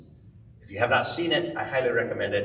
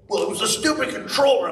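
high, louder protest imitating his son; whiny excuse; 12 semitones higher, 5 dB louder than usual. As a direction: blurt the excuse in a higher voice. Retelling his son's excuse.

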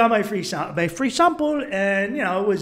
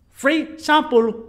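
put-on slow, high, loud Colombian-accented "Free sample"; mimicked Colombian accent; 9 semitones higher, 5 dB louder, much slower than usual. Tourist quoting hustler back, mimicked Colombian accent. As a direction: mimic the Colombian accent, stretching both words. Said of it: "You said free sample".

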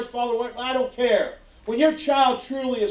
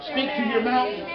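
usual speaking voice

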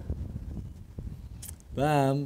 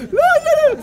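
high laughing no; laughing protest; 6 dB louder, faster than usual. Real, not acted.

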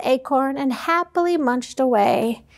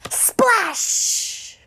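breathy splash; 6 semitones higher than usual. Acted. Sound effect word.

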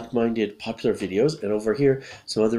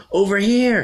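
high, loud call; loud, pitched-up holler; calling for attention; 10 semitones higher, 6 dB louder than usual. Acted. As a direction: call someone over from a distance. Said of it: "trying to get Kate's attention".

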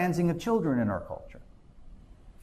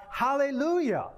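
higher-pitched cry of praise, no louder; 7 semitones higher than usual. Retold or imitated. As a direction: mildly proclaim it, high then falling. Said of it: "loud voice of a great multitude in heaven crying out".

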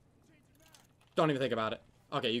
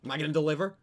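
tense hype; 3 semitones higher, 7 dB louder, faster than usual. Real, not acted. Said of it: "the pressure's on".

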